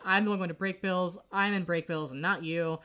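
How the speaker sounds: usual speaking voice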